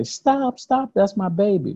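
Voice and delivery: high-pitched imagined mother's cry; voicing a mother's high, urgent cry to stop; imitated desperate plea. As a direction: call out high like a mother protecting her child. Imagined mother pleading to stop beating.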